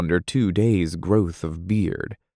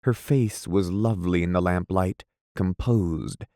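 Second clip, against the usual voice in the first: ordinary delivery, nothing marked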